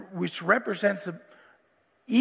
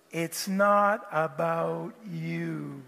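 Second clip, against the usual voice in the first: put-on broad singsong kids theatre; 5 semitones lower, 4 dB quieter, much slower than usual. Shown as a demonstration. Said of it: "Can you say it with me?".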